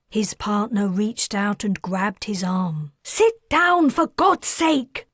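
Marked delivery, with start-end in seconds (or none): 3.0-5.1 much higher, loud, fed up; pleading angrily with her husband; exasperated order. Acted. Wife snaps at husband.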